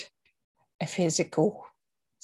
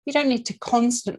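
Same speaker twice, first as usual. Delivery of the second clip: usual speaking voice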